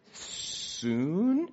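drawn-out, rising tentative answer; reluctant answer. Retold or imitated. Husband answering when he must go, hesitant and reluctant. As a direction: stretch it upward as if unsure.

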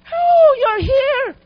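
a happy, drawn-out welcome; delighted greeting. Retold or imitated. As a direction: greet him with open delight. Voicing delighted greeting to God.

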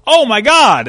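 high, loud imitation of a little girl; daughter's exclamation. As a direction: cry out like an excited child. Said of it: "I hear my daughter go".

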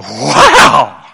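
a loud, awestruck wow; awed wow. Retold or imitated. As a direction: gasp out your amazement at God. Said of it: "those people are like".

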